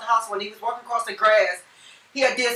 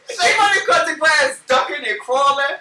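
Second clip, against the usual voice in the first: mock yell; 6 semitones higher, 7 dB louder than usual. Repeats the line louder.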